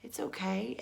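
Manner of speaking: soothing whisper. Pretend. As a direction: whisper comfort gently. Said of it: "I would say".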